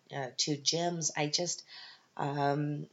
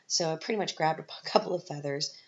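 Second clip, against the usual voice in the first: usual speaking voice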